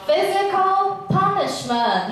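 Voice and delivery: Physical punishment drawn out slowly, sing-song; slow, sing-song teacher model; slow model pronunciation. Shown as a demonstration. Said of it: "can you repeat after me".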